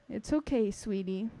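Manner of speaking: mother softly comforts her crying daughter; tender comfort. Acted. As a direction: hush her gently like a hurt child.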